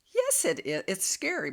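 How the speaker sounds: high, emphatic retort; insistent body's voice. Retold or imitated. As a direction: insist on it as your alarmed body. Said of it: "my body was saying".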